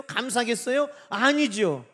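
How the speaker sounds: normal talking voice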